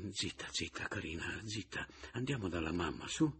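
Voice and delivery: put-on very soft murmur to a baby; hushed soothing. Man takes baby girl to her mother, hushed and soothing. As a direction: shush the little one, barely voiced.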